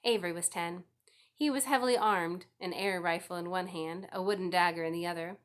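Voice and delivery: plain reading voice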